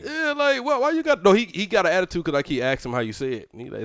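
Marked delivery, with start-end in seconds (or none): none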